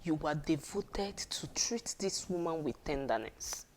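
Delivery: hushed, breathy whisper to the husbands. Shown as a demonstration. Preacher whispers tender devotion to the husbands. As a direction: say it like a secret.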